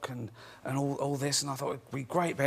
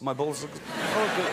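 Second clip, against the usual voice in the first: put-on slow comic Russian accent; laughing through a put-on Russian accent; funny Russian accent; 3 semitones higher, slower than usual. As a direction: answer in a silly mock-Russian voice. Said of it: "in a funny Russian accent".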